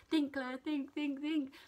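panicking passenger at passport control urging herself to think; urgent self-pep talk. Retold or imitated. Urgent inner voice.

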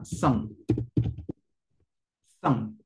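"cent" as a nasal "sahn", final t silent; French pronunciation. Shown as a demonstration. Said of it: "la pronunciación de C E N T".

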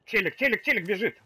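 loud urgent callout; excited callout. Real, not acted. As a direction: call out the enemy fast. Spotting an enemy in game.

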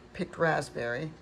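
ordinary talk, nothing marked

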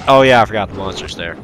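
ordinary talk, nothing marked

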